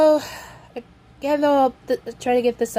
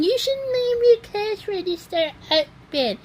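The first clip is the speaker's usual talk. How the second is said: imitated scolding; 10 semitones higher than usual. Gray lady scolding the cashier, mildly imitated anger. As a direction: imitate an angry customer at a higher pitch. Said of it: "angry at her".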